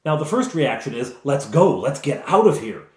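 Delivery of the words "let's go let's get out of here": louder, higher, pressing call; urgent urging. Acted. Rescuers urging each other to flee, urgent. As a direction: push them to flee at once. Party's first reaction to danger.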